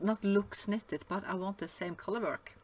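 usual speaking voice

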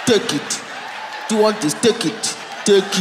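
put-on Nigerian accent, blunt and pushy; mock Nigerian accent. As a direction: sell it in a heavy Nigerian accent. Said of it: "the Nigerian accent".

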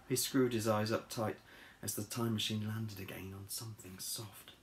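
usual speaking voice

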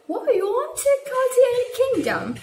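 high, quick imitation of a surprised teacher; mimicking an incredulous teacher; astonished teacher. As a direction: blurt it out in mock surprise, pitched high. Teacher's astonished reply, acted in story.

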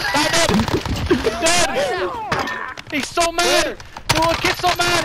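high excited gamer yelling. Real, not acted. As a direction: yell it out hyped. Yelling at enemy.

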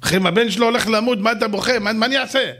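mild hammed-up weeping king; distraught king. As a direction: pour out despair to the advisor. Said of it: "המלך יושב ובוכה".

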